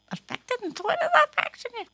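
high squeaky puppet voice, puzzled; a puppet cat confused by a big word; puppet voice asking. Acted. Puppet cat asking host what a word means, high and puzzled.

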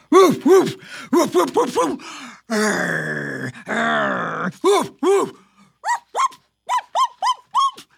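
loud barks, then very high yips; dog barking. Acted. Host barking like a dog scaring the kitty. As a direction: bark like a city dog.

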